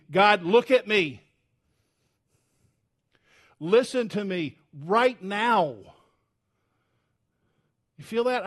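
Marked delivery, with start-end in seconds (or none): 0.1-5.7 louder, slow commanding call to God; urgent demand. Shown as a demonstration. Pastor showing the verse as a command, urgent and loud. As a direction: demand it, don't ask. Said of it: "It should be".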